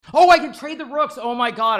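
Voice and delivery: sudden high, loud outburst of realization; shocked gasp at a missed chance; excited realization. Real, not acted. Realizes blunder in chess game.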